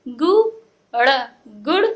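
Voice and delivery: "गु", "ड़" apart, then "गुड़" whole; broken, then joined. Shown as a demonstration. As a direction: sound out "गु" and "ड़", then blend to "गुड़". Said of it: "तोड़ तोड़ के पढ़ना".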